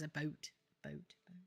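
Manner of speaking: ABOUT said softly as "a boat"; imitated Canadian about. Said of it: "He goes and says".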